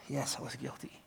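soft, half-whispered confession; defeated admission. Acted. Defendant acquiescing with the judge's declaration, hushed defeated admission. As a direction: admit it under your breath.

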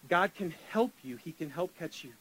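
ordinary talk

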